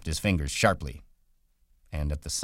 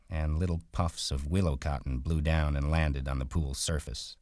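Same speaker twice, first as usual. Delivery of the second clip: ordinary delivery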